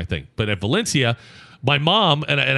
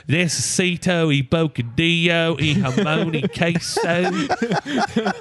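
put-on slow, higher American-accented Spanish; mom's bad Spanish; 4 semitones higher, much slower than usual. Mom repeating in language lab, slow American-accented Spanish. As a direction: imitate mom labouring through Spanish. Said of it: "I can hear my mom".

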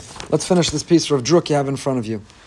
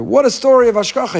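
higher, louder exclamation; marvelling, pitched up and punched out; enthusiastic quote; 8 semitones higher, 5 dB louder than usual. Retold or imitated. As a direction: read out an excited text message. Quoting an enthusiastic text message.

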